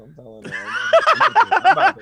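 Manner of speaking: high, loud playful shouting; mock outrage. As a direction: shout it like a comic tattletale. Playful outrage, threatens to tell author.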